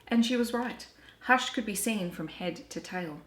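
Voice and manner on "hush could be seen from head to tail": ordinary delivery, nothing marked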